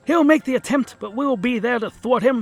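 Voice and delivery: put-on high, loud, jolly old chap; rallying the war council, hearty and high; hearty gentleman. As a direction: boom it out like a cheery toff. Gex's sidekick voices a hearty figure.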